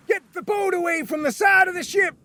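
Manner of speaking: captain shouting. Acted. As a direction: bellow to row clear of the ship. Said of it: "shouted the captain".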